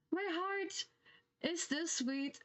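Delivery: swooning. Real, not acted. Swooning over the game hug.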